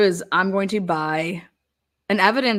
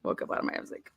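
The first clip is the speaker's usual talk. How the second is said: whispered; 12 dB quieter, much faster than usual. Real, not acted. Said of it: "unvoiced low".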